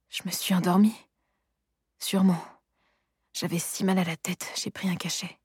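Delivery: soft, fading almost to a whisper; dazed murmur. A narrator's reading. Soline waking on the sofa, dazed and murmuring. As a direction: murmur to yourself, just woken.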